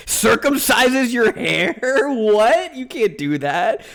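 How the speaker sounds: slow, high, disbelieving read of the message; reading a weird chat message, shocked and high. Real, not acted. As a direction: stretch it out in disbelief, then pop the what.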